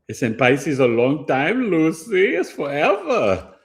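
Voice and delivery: high, drawn-out mock complaint; dramatic whine. Astrologer to viewers, high dramatic whine about Pisces. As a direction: stretch it up high like a diva.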